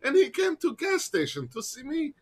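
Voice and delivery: high-pitched broken English voice; broken English impression. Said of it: "doing an impression".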